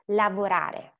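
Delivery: LAVORARE stressed la-vo-RA-re; stress next to last. Shown as a demonstration. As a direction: lean on the RA in LAVORARE. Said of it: "the stress goes there".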